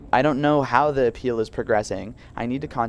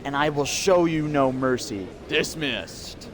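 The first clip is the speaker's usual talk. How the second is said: stern guard voice; 5 semitones higher than usual. Acted. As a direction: bark at the inmates. Guard's head count speech.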